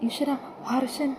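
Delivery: hushed, slow stunned cry of a name; quiet shocked breath on seeing the photos; shocked gasp. A narrator's reading. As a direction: gasp it out in disbelief, barely voiced. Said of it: "Karthika അങ്ങ് ഞെട്ടിപ്പോകുക".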